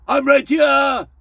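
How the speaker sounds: slow, loud, high barked Austrian action-hero shout; over-the-top Arnold Schwarzenegger yell; Arnie impression. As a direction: shout it slowly like Arnie in a fight scene. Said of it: "doing an impression of Arnie".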